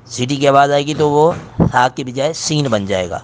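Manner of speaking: usual speaking voice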